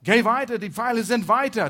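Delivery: high, loud shout into the distance; urgent, carrying call. Acted. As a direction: holler the order across a field. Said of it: "wenn ich zu ihm rufe".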